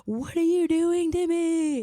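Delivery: put-on slow, high, loud teenage protest; exasperated teen. Teen protesting the move to parents, slow loud wail. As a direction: wail it like a put-upon sophomore. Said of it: "I was like".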